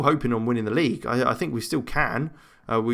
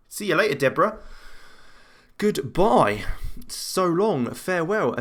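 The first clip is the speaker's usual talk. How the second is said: higher-pitched mock goodbye; mock farewell; 6 semitones higher than usual. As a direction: wave off the sent-off player in a playful high voice. Mock farewell to sent-off player.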